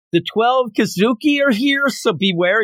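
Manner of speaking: high mock yell of a warning; retold yell. Demon calling back a warning, mock yell. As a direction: call it out high as a fleeing demon would. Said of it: "she's, like, yelling as she's going".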